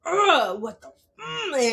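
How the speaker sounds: frustrated groan. Retold or imitated. Exasperated after hanging up on a caller, a mock furious groan. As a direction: replay the rage of hanging up.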